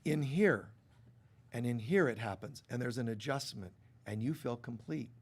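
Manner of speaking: ordinary talk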